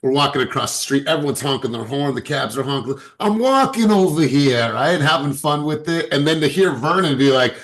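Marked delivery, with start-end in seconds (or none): 3.2-4.7 high, loud New Jersey accent, imitating a local friend. Telling the team how the Jersey friend talks, loud high New Jersey accent. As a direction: belt it out like a Jersey guy crossing the street, New Jersey accent mocked. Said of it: "his accent is deep".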